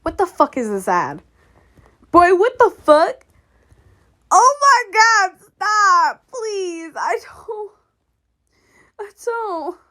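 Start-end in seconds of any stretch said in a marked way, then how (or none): 2.1-3.2 high, loud disbelief; loud exasperated. Real, not acted. Annoyed by ad. 4.3-9.8 whining. Real, not acted. Upset by ad.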